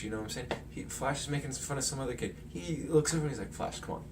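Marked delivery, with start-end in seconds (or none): 3.5-4.0 hushed, half-voiced urging; quiet mock plea; whispered plea. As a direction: whisper it so only Flash hears. Peter urging Flash to stop.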